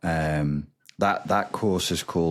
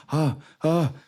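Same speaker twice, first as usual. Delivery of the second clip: higher, mock whiny fretting; deprived narcissist; 7 semitones higher than usual. Narcissist Frank missing his supply, higher mock whine. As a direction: fret like a starved narcissist. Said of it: "That's like".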